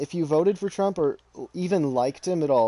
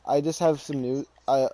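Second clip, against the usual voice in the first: usual speaking voice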